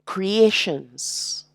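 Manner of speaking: ordinary talk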